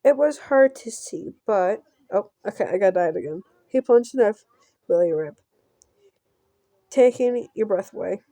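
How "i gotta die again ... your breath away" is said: normal talking voice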